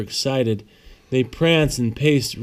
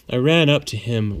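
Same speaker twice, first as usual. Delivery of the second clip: plain reading voice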